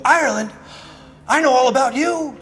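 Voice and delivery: mock excited welcome. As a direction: pitch climbing into an eager invitation. Acted bar local welcoming Irish stranger.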